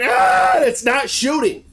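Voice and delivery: high frantic shout that settles a bit; panicked shout. Retold or imitated. Matthew shouting in panic at the jammed shotgun.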